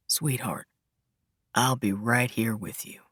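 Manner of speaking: low, soft Southern murmur; soft southern-tinged voice. A narrator's reading. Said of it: "telling her in his soft, southern-tinged voice".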